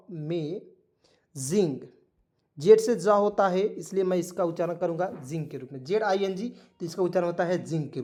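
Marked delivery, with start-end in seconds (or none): 0.1-1.8 slow drawn-out model word. Shown as a demonstration. Teacher models amazing for the class, slow and drawn out. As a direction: stretch amazing out slowly, every sound clear. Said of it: "इसे क्या पढ़ेंगे दोस्तों?".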